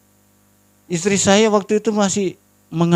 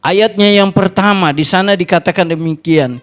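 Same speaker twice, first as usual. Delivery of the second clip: usual speaking voice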